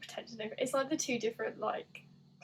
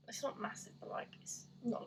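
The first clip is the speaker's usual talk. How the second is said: breathy near-whisper, much softer; whispering about the doll; whispered aside; 7 dB quieter than usual. Real, not acted. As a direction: let the voice drop to a whisper on the aside.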